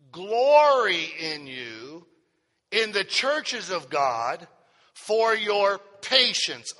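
slow, measured Bible reading; solemn scripture reading. Acted. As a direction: read the verse out slowly, phrase by phrase. Reads scripture aloud to congregation.

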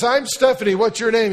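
put-on high, loud eager child voice; chirpy little girl. Girl asking Joe to look out the window, high and eager. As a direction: invite him brightly like a chatty kid.

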